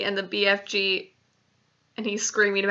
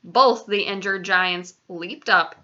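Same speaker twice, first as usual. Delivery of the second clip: usual speaking voice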